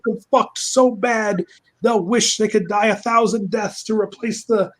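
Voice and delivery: high, booming proclamation of return; resurrected villain's grand rant. Acted. Cockhammer announces his return to his enemies, grandiose high rant. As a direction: declaim it high and pompous, vowing vengeance. Cockhammer roars back to life, ranting.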